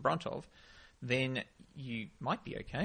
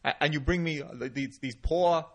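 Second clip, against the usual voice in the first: loud, higher Bronx gangster act; Bronx accent; 6 semitones higher, 7 dB louder than usual. Shown as a demonstration. Mobster threatening Jimmy over drug samples, loud mock Bronx accent. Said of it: "uh, a Bronx accent".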